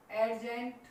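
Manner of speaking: "एडजेंट" with an audible D before the J; D sounded. Shown as a demonstration. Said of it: "एडजेंट नहीं बोलना". ("एडजेंट" में डी बोलकर)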